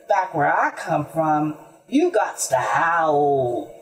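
low hound voice, slowing at the end; declaring hound. A narrator's reading. Hound answers the mule, low and declaring. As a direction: declare it low, drawing out howl. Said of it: "declared the hound".

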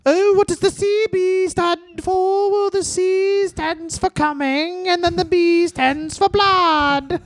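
high slow falsetto bit; shrill mock falsetto. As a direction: squeal it like a cartoon character.

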